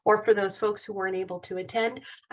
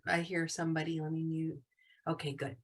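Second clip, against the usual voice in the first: quiet aside; 5 semitones lower, 9 dB quieter than usual. Real, not acted. Teacher muttering while muting.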